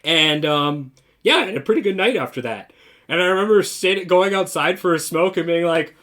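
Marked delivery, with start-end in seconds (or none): none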